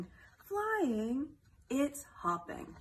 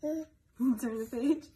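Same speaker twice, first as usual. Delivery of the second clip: usual speaking voice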